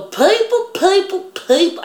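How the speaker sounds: hammed-up teacher calling "People"; imitating odd teacher. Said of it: "she talked like this".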